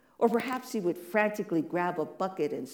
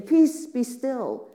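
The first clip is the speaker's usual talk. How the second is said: slow, loud and much higher grand command; big show; 6 semitones higher, 8 dB louder, much slower than usual. Retold or imitated. Jesus imagined standing in the boat, grand slow loud command. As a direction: proclaim it like a showy miracle worker. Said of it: "like putting on a really big show".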